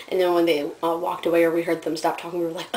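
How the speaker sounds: usual speaking voice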